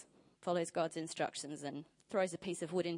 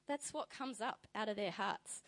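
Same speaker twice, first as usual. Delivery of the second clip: ordinary talk, nothing marked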